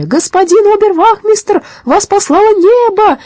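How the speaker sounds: very high-pitched joyful cry; shouting in chorus. A narrator's reading. Captives greeting their rescuer, high chorus shout. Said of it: "хором кричали".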